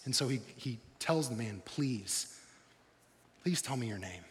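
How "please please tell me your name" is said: soft, low pleading please; a hushed, humble plea. Retold or imitated. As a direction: beg quietly for the name. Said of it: "It's almost like he's pleading".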